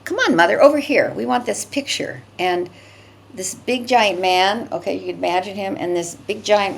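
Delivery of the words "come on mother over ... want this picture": high, loud and bossy; pushy woman. Retold or imitated. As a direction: yank her over with your voice. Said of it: "like, violently".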